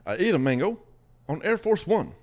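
hammed-up presidential mango pitch; imitating Obama. Host imitates the president for a fruit game, mock impression. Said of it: "I should do, uh, President Obama".

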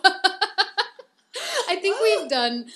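laughing through the words. Real, not acted. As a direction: burst out laughing mid-sentence. Laughing at never introducing themselves.

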